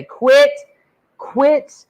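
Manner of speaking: snapping back in a quarrel; angry outburst. Retold or imitated. Girl yelling at her man to quit exploiting her. Voicing an angry argument partner.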